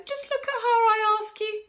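very high, loud exclamation; crying out. A narrator's reading. Urging Mister Wonka to look at Josephine, crying out. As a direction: cry out in scandalised alarm. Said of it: "cried Grandma Joe".

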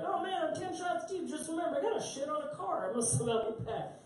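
high-pitched drunk buddy impression; mock drunk bar-goer. Drunk man announcing his reckless exit from the bar, mock high voice. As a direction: put on a high, silly drunk voice.

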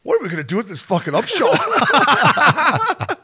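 high, loud wail cracking into laughter; mock despair, laughing. Coaches at a loss over a young player, laughing mock despair. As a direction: imitate helpless exasperation while cracking up.

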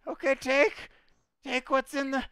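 very high-pitched, submissive little goblin; captive goblin. Acted. Captured goblin offers help to the party, high squeaky voice.

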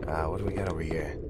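quick hushed whisper. Real, not acted. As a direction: whisper fast so nothing hears. Sneaking through a dark kitchen.

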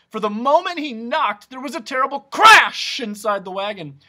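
high, very loud storytelling burst; dramatic alarm. A narrator's reading. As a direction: build loudly to a startling CRASH. Narrator dramatizes a sudden crash.

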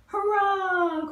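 high, loud cheer; cried. Acted. Bugs celebrating their win, loud cheer. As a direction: cheer the victory. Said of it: "cried the bugs".

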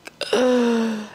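sharp re-enacted gasp; shocked gasp. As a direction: gasp as if hit by scary news. Reacting to frightening diagnosis news.

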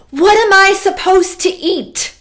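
high-pitched acted protest; loud complaint. Camp counselor to the director in the lunch line, high complaining. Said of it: "I complained out loud in front of everybody".

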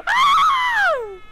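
very high shriek into a sob; real scream, part crying. Real, not acted. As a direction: scream with it, half laughing. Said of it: "I'll scream with you".

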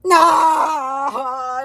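long, high wailed no; drawn-out cry of refusal; protesting shout. Acted. Protesting at being bought.

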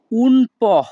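"po" said as a short po, like Bengali প; Italian "un po", ending on a short po. Shown as a demonstration. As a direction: say "po" cleanly as po, not pio. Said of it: "এখানে পিও এর উচ্চারণ হবে প".

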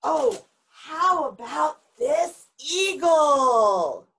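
slow, drawn-out sales flourish; showy presentation. Acted. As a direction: unveil the animal with great fanfare, stretching the last word. Worker presents an eagle grandly.

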